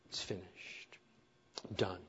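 breathed out almost silently; hushed. Real, not acted. Preacher quietly savoring the finished work, hushed. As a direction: let it fall to a near whisper. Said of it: "hushed aside after the story".

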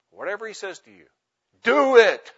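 pause, then punch out "do it" forcefully; stress on do it. Shown as a demonstration. Preacher squeezing the verse word by word, stress on do it. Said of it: "emphasizing one word at a time".

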